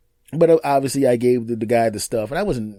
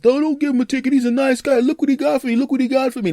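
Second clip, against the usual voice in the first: much higher, louder pleading man's voice; homeless man pleading; 12 semitones higher, 4 dB louder than usual. Retold or imitated. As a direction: plead his case in a high, strained voice. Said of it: "the guy that's sitting on the ground ... says".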